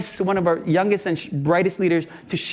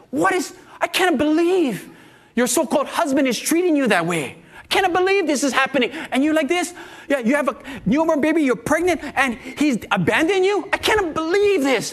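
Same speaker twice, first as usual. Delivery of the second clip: higher-pitched retold outrage; indignant anger; 6 semitones higher than usual. Pastor angry at a friend's abandoning husband, indignant. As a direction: get angry on her behalf. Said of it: "I'm getting angry for her".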